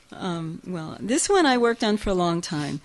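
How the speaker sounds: usual speaking voice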